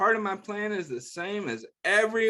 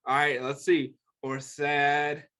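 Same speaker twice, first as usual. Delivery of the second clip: ordinary delivery, nothing marked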